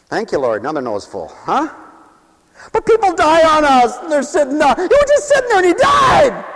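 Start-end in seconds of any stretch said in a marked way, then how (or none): 4.8-6.4 shocked outcry. Acted. Acted shock at a sudden death.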